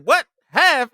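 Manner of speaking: high, loud outraged "Half?"; shocked disbelief. Retold or imitated. Rapper hearing half his check goes to tax, loud disbelief. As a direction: squawk it like you can't believe it. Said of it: "when he was like".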